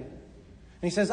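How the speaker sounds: normal talking voice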